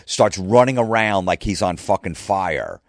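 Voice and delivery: ordinary talk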